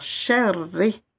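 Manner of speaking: sherri with the r doubled and held; insisted r. Shown as a demonstration. Teacher reading the word, insisting on double r. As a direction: lean on the r in sherri as if twice. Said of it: "J'insiste sur le ra comme si je le prononçais deux fois".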